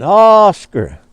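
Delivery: mild calling to a far-off alligator. Retold or imitated. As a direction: call the alligator over the water, lightly. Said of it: "So he started calling".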